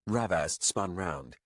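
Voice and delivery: usual speaking voice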